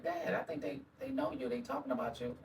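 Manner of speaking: high, soft imitation of his daughter; young daughter. Guest voices his daughter alerting him at the mall, soft. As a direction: voice a girl quietly tipping off her dad. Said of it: "she was like".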